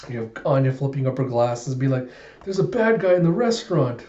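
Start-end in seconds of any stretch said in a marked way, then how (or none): 2.4-4.0 put-on high little-girl announcement; alarmed child. Girl spots a villain in the restaurant, childlike alarm. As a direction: report danger as a small child would.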